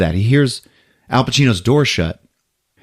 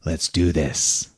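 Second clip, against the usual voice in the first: low, soft, slow line; hushed, deliberate invitation; retold quiet challenge; 7 semitones lower, 7 dB quieter, slower than usual. Retold silent challenge across park.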